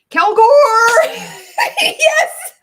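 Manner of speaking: very high, loud laughing cheer; delighted shout. Real, not acted. Streamer greeting a returning viewer, squealing laugh. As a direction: squeal with joy.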